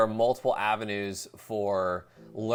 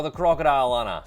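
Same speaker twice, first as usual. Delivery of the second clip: higher, louder mock Australian accent, crocodile stretched out; bad Australian accent; 4 semitones higher, 9 dB louder, faster than usual. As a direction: belt out a hammy Australian cliché. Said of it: "See, my accent is just as bad".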